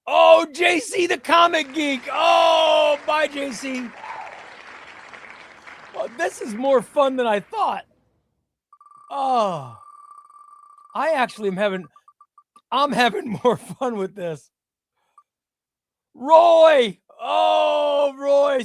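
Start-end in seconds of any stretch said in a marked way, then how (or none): 0.0-3.9 slow, much higher, louder drawn-out shout; dramatic callout. Real, not acted. Streamer announcing a viewer's elimination, slow dramatic shout. 16.1-18.6 much higher, louder shout of the name; excited callout. Real, not acted. Streamer reacting to a viewer's elimination, high mock-shocked shout.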